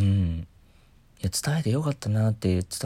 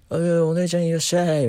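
playful stall vendor; 7 semitones higher, 4 dB louder than usual. Pretend. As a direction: pretend festival vendor calling out, higher pitch. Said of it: "みたいなことをね、あの、鉢巻き巻いてさ、やってみたくって". (屋台の店員ごっこの高めの呼び込み)

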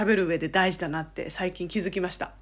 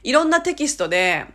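ordinary talk

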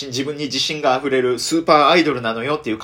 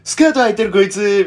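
mock jeer from schoolboys, raised and louder; mimicking boys teasing; teasing classmate boys; 7 semitones higher, 5 dB louder than usual. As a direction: tease her like a mean kid. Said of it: "みたいな".

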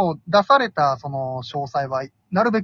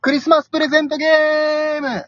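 excited announcement; 10 semitones higher than usual. Acted. Announcing the game title.

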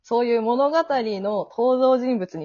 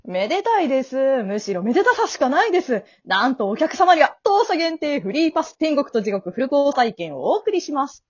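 bubbly prize-announcer patter; cheerful afterlife salesperson; gleeful grim reaper guide; 6 semitones higher, 3 dB louder than usual. Acted. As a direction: sell the prize with lilting enthusiasm. Death guide presents free pass.

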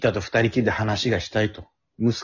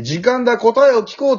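high, loud gloating villain; 11 semitones higher, 5 dB louder than usual. Retold or imitated. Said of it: "って言う".

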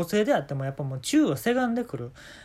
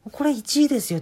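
boastful ad claim; 8 semitones higher, 5 dB louder than usual. Retold or imitated. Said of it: "って言ったらあかんやん".

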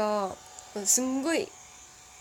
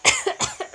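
choking laugh; 9 dB louder than usual. Real, not acted. Laughing until she chokes.

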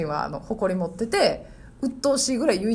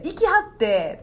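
higher-pitched Osaka-style honorific; Osaka dialect; 6 semitones higher, slower than usual. Shown as a demonstration. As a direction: demonstrate how Osaka people say it. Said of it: "大阪の人って". (大阪弁の言い方で)

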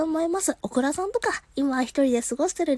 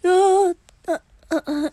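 flustered exclamation; 6 dB louder than usual. Real, not acted. Reacting to caller's lonely Christmas.

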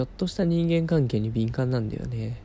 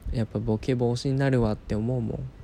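ordinary delivery

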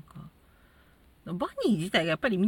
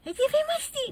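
very high cutesy moe greeting; sweet moe voice, pitched way up; high cute anime girl; faster than usual. Retold or imitated. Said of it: "みたいな感じで頑張った". (高い萌え声で)

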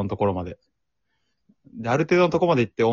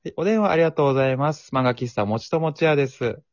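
bright, higher polite phone greeting; customer service voice; 4 semitones higher than usual. Pretend. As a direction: answer like a cheerful shop clerk. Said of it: "ちょっともう一回やります".

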